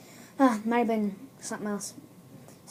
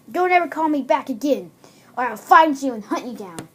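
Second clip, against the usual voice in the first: louder, threatening outburst; freaked out; 3 semitones higher, 8 dB louder than usual. Acted. Freaked-out girl to creepy caller, loud threat. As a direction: warn off the caller loudly. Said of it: "she freaked out and like".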